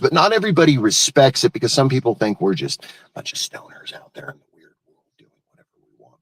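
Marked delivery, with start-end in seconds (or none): 2.1-6.2 breathy conspiratorial whisper. Real, not acted. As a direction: drop to a quick whisper. Hushed aside about stoners.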